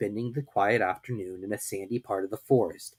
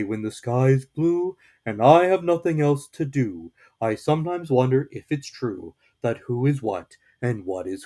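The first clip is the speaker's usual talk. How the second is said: Pooh reciting poetry; 6 dB louder than usual. A narrator's reading. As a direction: recite it proudly like a simple bear. Pooh recites his poem.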